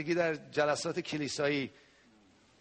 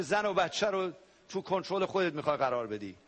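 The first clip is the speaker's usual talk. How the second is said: normal talking voice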